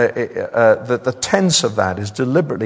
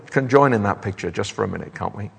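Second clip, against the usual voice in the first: ordinary delivery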